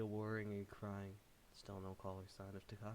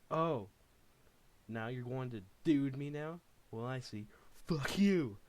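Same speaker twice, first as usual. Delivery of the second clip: loud, high, outraged yelling; furious shout; 5 semitones higher, 11 dB louder than usual. Acted. Davey turning on Takashi in a jealous rage. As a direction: blow up at him, sneering on dude.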